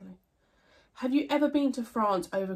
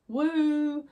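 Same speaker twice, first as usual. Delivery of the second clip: higher, louder pretend party whoop; mock cheer; 8 semitones higher, 7 dB louder than usual. Not one for celebrating another year over, mock cheer. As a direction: give a loud, put-on woo.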